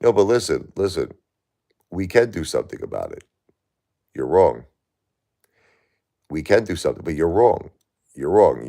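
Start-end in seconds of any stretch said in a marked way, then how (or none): 4.1-4.6 flat, louder you're wrong; a blunt, emphatic correction; firm rebuttal. Real, not acted. Firmly contradicting the host. 8.1-8.7 flat, louder you're wrong; a blunt, emphatic correction; firm rebuttal. Real, not acted. Repeating the rebuttal.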